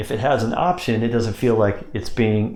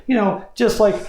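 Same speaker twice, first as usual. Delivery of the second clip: much higher and a bit louder; high pitch; 12 semitones higher, 4 dB louder than usual. Shown as a demonstration. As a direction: lift into a high easy tone. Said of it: "talk in a high pitch on this too".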